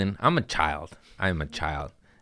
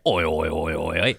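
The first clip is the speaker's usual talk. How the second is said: low, lightly imitated Australian chant; mock Australian; 3 semitones lower than usual. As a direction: repeat it as a light Australian parody. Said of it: "I thought you spoke English".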